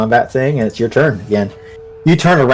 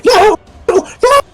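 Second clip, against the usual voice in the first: dying scream; slower than usual. Acted. Man being torn apart by wolves, high screams dying off. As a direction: shriek in agony, last one weaker. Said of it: "scream as his bowels are ripped".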